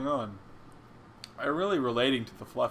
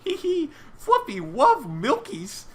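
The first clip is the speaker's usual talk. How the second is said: put-on very high, babyish giggly squeal; slow, high-pitched cutesy baby voice; baby fluffy cries; 13 semitones higher, 3 dB louder, slower than usual. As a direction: put on a tiny creature's baby talk. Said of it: "murmurs of babies echo out. Cries of".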